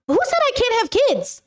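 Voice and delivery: high-pitched indignant question; indignant retort. Real, not acted. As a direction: throw it back high, falling at the end.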